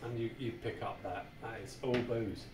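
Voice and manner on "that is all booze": usual speaking voice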